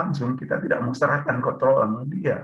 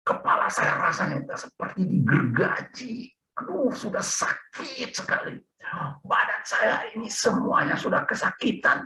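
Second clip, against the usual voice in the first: pained prayer; 10 semitones higher than usual. Retold or imitated. Preacher re-enacting his prayer during an attack, pained and high. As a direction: plead in agony, re-enacting the moment. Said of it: "Apa doa saya?".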